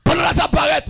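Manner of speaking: high, forceful preacher's burst; sudden outburst. Acted. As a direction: burst out high after the hush. Same words burst out loud. (eklate ak vwa wo)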